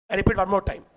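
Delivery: ordinary delivery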